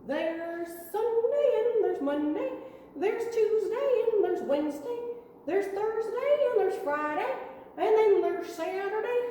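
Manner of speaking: high, lively cowboy sing-song. Shown as a demonstration. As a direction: list the days like a cheery cowboy. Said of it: "Let's do cowboy voices".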